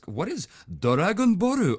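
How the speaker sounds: high, slow mock Japanese accent, laughing; laughing Japanese accent read. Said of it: "phonetically as though it was said in a Japanese accent".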